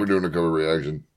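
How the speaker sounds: flat mechanical robot voice. Acted. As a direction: drone it out like a robot. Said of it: "This is my robot voice".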